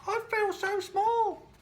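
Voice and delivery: very high, slow, wondering little voice; small snail voice full of awe; tiny snail in awe. Acted. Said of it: "she said to the whale".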